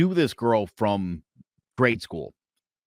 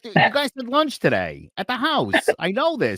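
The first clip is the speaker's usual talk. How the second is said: high, put-on voice of the ex-wife; lightly mock her high voice; imitating ex-wife; 13 semitones higher, 5 dB louder than usual. Quoting ex-wife to the guest, high mock voice. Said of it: "It's like".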